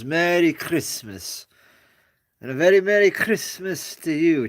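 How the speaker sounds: a hint of Mister Hooper, stressing merry and very; mild imitation of the emphatic holiday wish; Mister Hooper greeting. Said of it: "with that emphasis".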